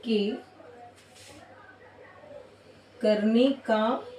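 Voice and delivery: slow, spaced-out dictating with long pauses; slow classroom dictation; dictation pace. Shown as a demonstration. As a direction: read it out word by word so students can write. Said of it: "dictating the definition slowly".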